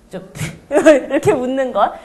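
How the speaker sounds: a short burst of laughter shown; laugh demo. Shown as a demonstration. Teacher showing what bursting with laughter sounds like, laughing. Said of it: "그래서 이렇게".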